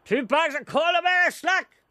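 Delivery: a high, loud yelled street call; retold shout. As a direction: bellow it across the street. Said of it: "I shouted".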